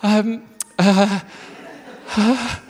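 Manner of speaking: acted stammering for an answer, long gaps; flustered hesitation. Customer asked "anything else", flustered and hesitant. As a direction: hem and haw, lost for words. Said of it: "I said, uh".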